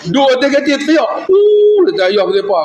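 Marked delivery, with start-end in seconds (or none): 1.3-1.8 high, drawn-out crying "uuh"; mock wail. Son imitating his weeping widowed father, mock wail. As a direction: imitate a loud wail, pitched way up.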